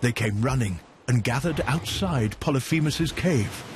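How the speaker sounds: usual speaking voice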